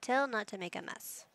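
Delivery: ordinary talk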